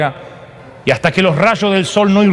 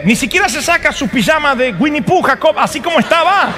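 ordinary talk, nothing marked

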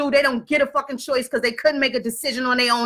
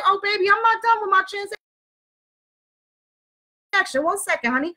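sugary polite brush-off, higher; overly polite; 6 semitones higher, faster than usual. Retold or imitated. To the pushy guy at the counter, sugary polite. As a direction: sweet-talk him off with fake politeness. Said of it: "I'm very polite".

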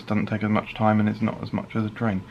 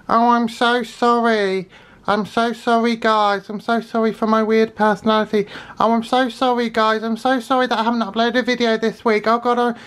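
much higher whiny mock-apology voice, slowing on the sorries; mocking apologetic YouTuber; 11 semitones higher than usual. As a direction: parody a YouTuber grovelling to fans. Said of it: "they dwindle into self-love".

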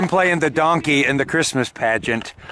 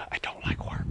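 whispered joking aside; 13 dB quieter than usual. Real, not acted. After cutting through thick ice, a whispered joke to camera. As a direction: breathe it out as a secret.

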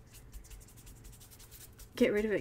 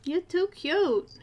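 cooing at the cat; 6 semitones higher, 4 dB louder than usual. Real, not acted.

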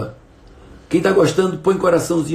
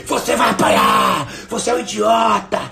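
put-on a yelled threat at a child; mock yell of the wrong way to discipline; angry shouting parent; 5 semitones higher than usual. Shown as a demonstration. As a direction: bark it out like an enraged father. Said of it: "Não gritei".

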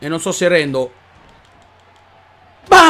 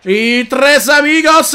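slow, loud, higher call of the move; drawn-out shout; 5 semitones higher, 4 dB louder, much slower than usual. Real, not acted. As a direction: stretch Tres Amigos like an announcer. Calling signature move.

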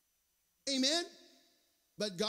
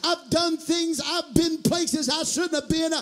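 loud, high, rhythmic sermon shout; full-force preaching; 7 semitones higher, 14 dB louder than usual. Real, not acted. Preacher voicing the self-doubter then promising God's help, full-force preaching. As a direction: drive each phrase hard with a punched ending.

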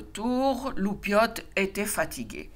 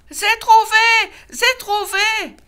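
excited cub; 14 semitones higher, 9 dB louder, slower than usual. A narrator's reading. Loupiote runs back to papa.

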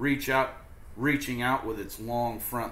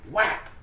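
whack sound; 10 semitones higher, 4 dB louder than usual. A narrator's reading. Tail slaps the ladybug.

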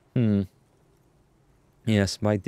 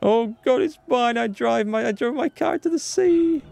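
high, drawn-out mock wail; theatrical high-pitched lament; hammed-up despair. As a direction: wail it on a high held pitch, rising further at the end. Player despairs at finding sunken car.

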